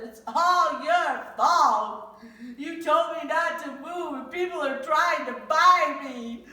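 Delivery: loud, high, slow wailing complaint; upset whine. Acted. Tyra blaming her father, high loud wailing whine. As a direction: wail it like a wronged kid.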